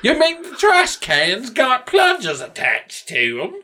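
high-pitched cartoon British voice; incredulous Brit parody; mock British. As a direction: put on a silly, high British accent. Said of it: "most British sounding shit".

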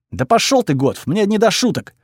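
high-pitched annoyed brush-off; acted exasperation, no shouting; exasperated retort. A narrator's reading. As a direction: pitch jumps up, fed up with the joking. Hero angrily brushes off the joke.

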